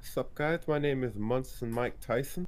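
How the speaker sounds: high, soft lisping boxer voice; high and breathy with a lisp; Mike Tyson impression. Shown as a demonstration. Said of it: "It's like a really high-pitched voice".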